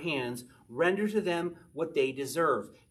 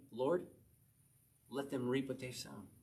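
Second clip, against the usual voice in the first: softer, hushed plea to God; soft prayer; 3 semitones lower, 8 dB quieter than usual. Retold or imitated. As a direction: pray it quietly, letting go of revenge. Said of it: "He's saying".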